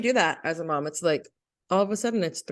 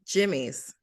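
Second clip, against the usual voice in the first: put-on Boston accent; 3 semitones lower than usual.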